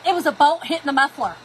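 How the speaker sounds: loud, laughing through the reveal; crowing that she was right; laughing triumph. Real, not acted. Telling viewers the noise was the bolt, laughing loudly.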